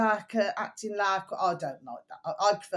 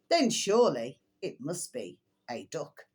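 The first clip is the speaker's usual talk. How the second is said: ordinary talk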